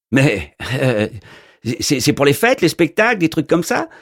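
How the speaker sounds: a goofy postman who can't believe it; incredulous mailman. A narrator's reading. Postman asking if the wizard does party shows, incredulous.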